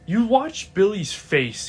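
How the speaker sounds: ordinary talk, nothing marked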